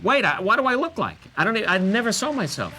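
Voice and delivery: high, loud retold objection; panicked protest. Performer rushed on before seeing the mirror, mock panic. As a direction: blurt it out in mock alarm. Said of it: "and I went".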